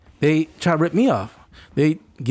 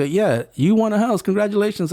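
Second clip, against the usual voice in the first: imitated prize caller; 7 semitones higher than usual. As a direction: play the insistent upbeat caller. Voices caller repeating prize news.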